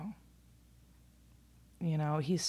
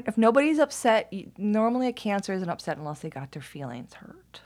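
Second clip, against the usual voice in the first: ordinary delivery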